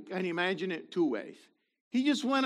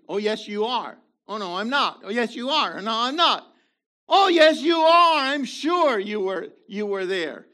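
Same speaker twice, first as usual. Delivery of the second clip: mock accusing quarrel; 4 semitones higher, 8 dB louder than usual. Acted quarrel accusing Peter.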